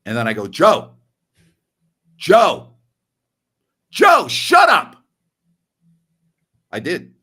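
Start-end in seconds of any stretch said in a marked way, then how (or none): 0.5-4.9 high, loud shout, escalating with each try; exasperated full-voice shout; shouting him down. Acted. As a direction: yell over someone who won't stop, each attempt higher. Shouting down an angry caller.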